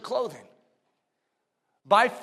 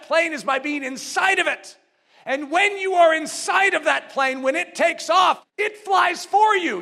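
high-pitched, driving preacher's voice; forceful preaching; 8 semitones higher, 7 dB louder than usual. Real, not acted. Preacher urging trust through a plane analogy, forceful preaching. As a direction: urge them with rising conviction.

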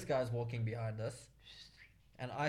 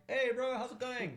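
much higher, louder mock hello; hammed-up friendly greeting; 11 semitones higher, 4 dB louder than usual. Thought the whistle was a greeting, hammed-up friendly hello. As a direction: greet a buddy in an exaggerated high voice.

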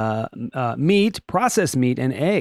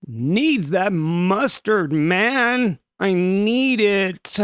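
drawn-out, hammed-up desperation; desperate craving; 4 semitones higher, much slower than usual. Overworked man craving his mustard, mock desperate. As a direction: stretch it slowly, like a man cracking up.

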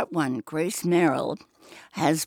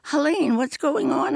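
a higher, startled cry of her sister's name; shocked question; 10 semitones higher than usual. Acted. Heroine sees her sister holding a gun, startled and high. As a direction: let the shock jump up at the name.

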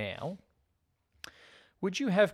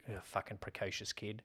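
low-volume, disgusted aside; retold grumble; 3 semitones lower, 12 dB quieter than usual. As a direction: grumble it softly under your breath. Retold reaction to other movies.

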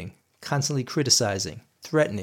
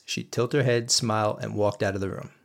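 normal talking voice

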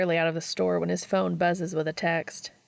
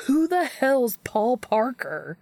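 louder and higher, slow, disbelieving; exasperated outburst; 6 semitones higher, 5 dB louder, slower than usual. A narrator's reading. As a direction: stretch it out in incredulous protest. Dean baffled by wrong name.

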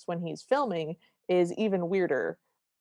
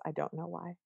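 normal talking voice

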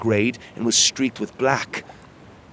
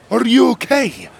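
worried gruff captain; 13 semitones higher, 8 dB louder than usual. Acted. Magborn worried after rescue.

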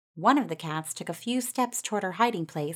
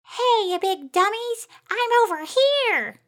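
high, loud mocking holler; yelling; 6 dB louder than usual. A narrator's reading. Mouse waves and baits the cats away from friends, high yell. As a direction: yell high to grab their attention. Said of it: "and yelled".

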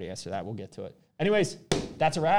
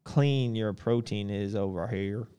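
playfully put-on Southern accent, louder at the start; mock Southern accent; 5 dB louder than usual. Host jokes about clean protein in Minnesota, mock Southern accent. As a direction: lay on a pretend Southern accent. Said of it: "That's a Southern accent".

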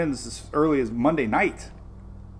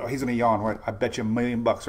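normal talking voice

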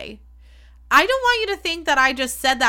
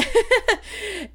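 high laugh; 11 semitones higher than usual. Real, not acted. Laughing about friend story.

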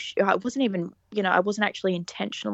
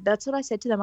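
usual speaking voice

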